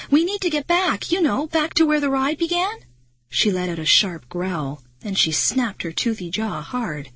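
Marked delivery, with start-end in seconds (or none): 0.1-2.8 high, earnest explanation; pleading, raised-pitch explanation; anxious explaining. A narrator's reading. Frightened child explaining to werewolf.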